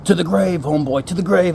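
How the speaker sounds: loud put-on warning voice; emphatic imitation of a warning; stern secrecy demand. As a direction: insist on secrecy with mock gravity. [name] again demands secrecy.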